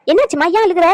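alarmed questioning. Acted. Asking mother why she was crying, alarmed. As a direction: demand to know what is wrong.

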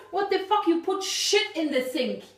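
ordinary delivery

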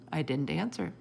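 usual speaking voice

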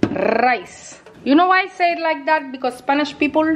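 usual speaking voice

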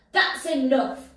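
loud firm shout. Acted. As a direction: shout it to stop him. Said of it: "shouted Sue Potato".

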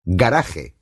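GARAJE as "ga-RA-he", Spanish jota; Spanish pronunciation. Shown as a demonstration. Instructor shows learners the Spanish diction, Spanish pronunciation. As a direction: say GARAJE in Spanish, stressed on the middle. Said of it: "la dicción a la española".

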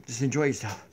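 usual speaking voice

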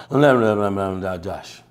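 low mock mumble, words run together; mumbling. Sales rep mumbling his name at the homeowner's door. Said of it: "He mumbled to him one more time".

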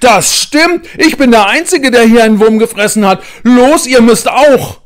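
put-on high, loud outraged boy's demand; Paul outraged, demanding fairness; indignant. A narrator's reading. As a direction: insist furiously they must too. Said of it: "sagt Paul empört".

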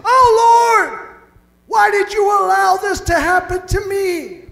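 high, loud whining plea; mock lament. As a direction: cry out to God like a self-pitying victim. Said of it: "your reaction is".

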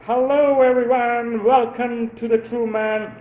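high, loud hammed-up Jim Carrey host voice, laughing at the end. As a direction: belt it out like an overexcited TV host welcoming everyone. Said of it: "What would Jim Carrey say?".

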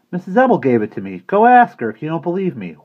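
put-on high, loud, bratty kid voice; a sulky boy daring the teacher; boy shouts back at the teacher, high and bratty; defiant boy. Bradley defiant child voice.

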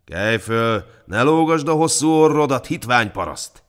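loud guard berating a peasant; overbearing, loud telling-off; gruff scolding. A narrator's reading. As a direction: scold him, building to a high, loud insult. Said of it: "mordult rá a hajdú".

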